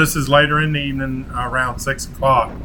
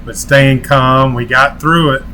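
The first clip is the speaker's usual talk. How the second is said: outro sign-off; 4 dB louder than usual. Acted. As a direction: sign off to viewers like a channel host.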